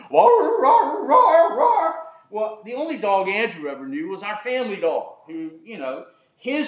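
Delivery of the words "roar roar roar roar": high, loud mock hound baying; hammed-up dog howl; imitated baying dogs. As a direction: howl like beagles on the hunt. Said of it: "you could hear 'em coming".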